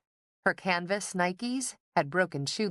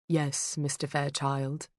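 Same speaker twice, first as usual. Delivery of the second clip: grave butler. A narrator's reading. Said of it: "His voice was grave, lightly British".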